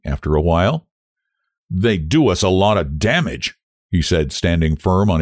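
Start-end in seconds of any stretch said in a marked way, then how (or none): none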